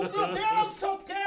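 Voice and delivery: high and loud, laughing in disbelief; startled laugh at own fall; laughing shock. Retold or imitated. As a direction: cry out in amused shock. Retold shock on broken stage.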